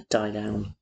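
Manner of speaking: Diane said softly as Welsh da iawn; Welsh pronunciation. Shown as a demonstration. Learner proudly showing off a Welsh phrase, softly. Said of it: "Diane means "Very well".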